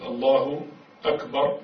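slow الله أكبر, then a full stop; said on its own. Shown as a demonstration. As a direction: set الله أكبر apart, ending on a silent r. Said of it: "يجب أن تكون وحدها".

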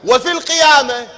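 fiery sermon climax; rising preaching shout. Real, not acted.

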